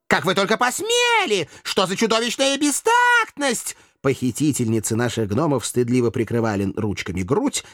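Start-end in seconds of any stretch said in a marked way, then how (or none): 0.1-3.8 very high, thin indignant voice; high-pitched feminine outrage; outraged river maiden. A narrator's reading. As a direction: squeal with offended dignity. Outraged nereids in the story.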